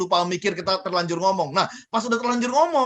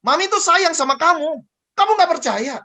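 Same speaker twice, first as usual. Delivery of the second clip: higher, louder nagging mother; mother's harsh scolding; 7 semitones higher, 5 dB louder than usual. Retold or imitated. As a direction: snap at the child as an exasperated mom. Mother scolding child, wrong tone.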